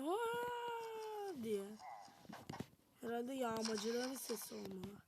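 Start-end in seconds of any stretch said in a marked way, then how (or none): 0.0-1.4 high, strange drawn-out mock yell; imitated raider yell. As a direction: mimic a weird distant shout. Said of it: "Bir garip bağırma sesi geldi". (tuhaf, tiz taklit bağırma)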